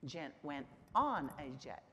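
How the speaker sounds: teacher's demo emphasizing on; stress on on. Shown as a demonstration. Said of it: "look at the different emphasis".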